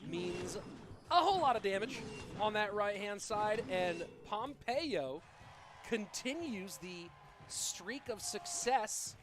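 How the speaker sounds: live play-by-play. Acted. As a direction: hype the big hit, then ask the question. Calls the match live.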